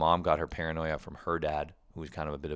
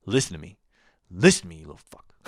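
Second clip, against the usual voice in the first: loud, fast, harsh mom voice scolding; mock angry mom; 8 dB louder, faster than usual. Mother scaring her six-year-old son about injuries, harsh mock shout.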